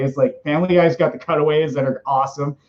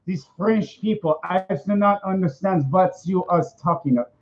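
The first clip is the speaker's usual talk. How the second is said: higher, halting mock French-German accent; vaguely German comic accent; put-on European accent; 4 semitones higher than usual. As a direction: play a baffled foreigner with a muddled European accent. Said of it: "Is that some more German?".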